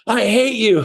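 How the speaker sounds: high-pitched imitation of an angry prospect; mock hateful yell. Hostile homeowner to cold-calling agent, mock hateful yell. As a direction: imitate someone spitting out hate, half-laughing.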